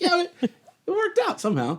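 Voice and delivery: high, laughing through the words; laughing relief. Retold or imitated. Actor recalling finally landing the role, laughing. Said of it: "And I was like".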